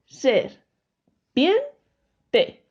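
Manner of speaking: "cra, ne, o" with a short pause after each part; syllable by syllable. Shown as a demonstration. Teacher repeating the split for the class, syllable by syllable. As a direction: space out "cra", "ne", "o" evenly. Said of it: "Si separamos serpiente en sílabas".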